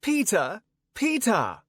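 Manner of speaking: high-pitched woman calling a name; searching woman. A narrator's reading. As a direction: call out high for someone lost. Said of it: "said a woman by a fruit stand who looked to have lost".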